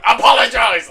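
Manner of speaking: loud, high mock command; hammed-up imperious shout; demanding. Demon Lord confronts insulting wolves, demanding.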